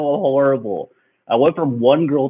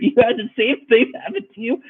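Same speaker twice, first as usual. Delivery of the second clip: laughing disbelief; 12 semitones higher than usual. Real, not acted.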